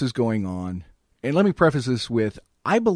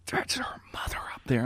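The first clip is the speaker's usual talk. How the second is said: urgent unvoiced shout-whisper; whisper yelling; 8 dB quieter than usual. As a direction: hiss the warning hard but without voice. Date hisses warning in church.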